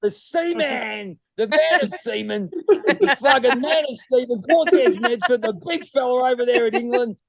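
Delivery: big him up like a ring announcer; hype-man intro. Acted. Hyped-up introduction of a guest.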